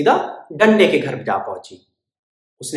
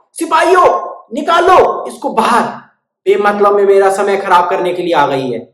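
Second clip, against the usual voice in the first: high, loud royal order; king ordering soldiers to throw the bird out, loud; commanding shout; 4 semitones higher, 9 dB louder than usual. Acted. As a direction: bark it at the soldiers.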